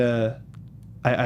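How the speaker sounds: ordinary delivery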